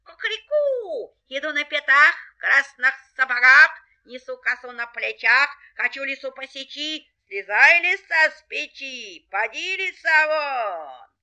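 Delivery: high, loud, chanting rooster threat; crow, then shout the rhyme out rhythmically. Acted. Rooster orders the fox off the stove, commanding.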